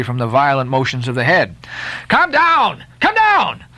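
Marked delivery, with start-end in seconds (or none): 2.1-3.6 high, repeated warning yell; urgent yell. A narrator's reading. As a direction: yell up to him to get down. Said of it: "yelled the seaman to Daggoo".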